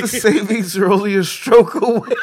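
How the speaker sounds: high laughing voice. Real, not acted. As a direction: say it mid-laugh. Laughing fit.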